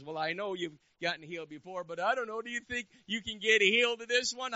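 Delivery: loud, climbing to a high-pitched challenge; taunting enemy. Acted. Enemy of the soul questions his healing, loud taunting doubt. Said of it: "the enemy of my soul comes to me and says".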